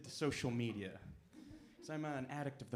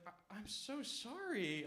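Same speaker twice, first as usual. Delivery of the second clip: sympathetic; 7 semitones higher, 6 dB quieter, slower than usual. Retold or imitated. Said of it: "my girlfriend was like".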